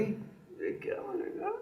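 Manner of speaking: slow breathy whispered tease; teasing whisper. Acted. Lover whispering a teasing question to his queen, slow whisper. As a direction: lean in and whisper it slowly.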